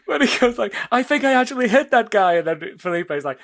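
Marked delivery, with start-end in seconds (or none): 0.9-2.3 fast, high, loud boast; mock excitement. Retelling him trying a gun the first time, mock excitement. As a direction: blurt it out in imitated amazement.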